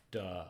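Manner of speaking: "duh" with a short uh vowel; "duh" to show the vowel; English duh. Shown as a demonstration. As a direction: say "duh" as a plain English word. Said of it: "closest equivalent ... like in English".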